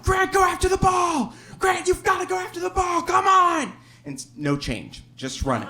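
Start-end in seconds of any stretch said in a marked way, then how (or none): 0.0-3.7 much higher, louder mock shout; hollering at a kid on the field, frantic; dad yelling from stands. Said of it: "he is losing his mind".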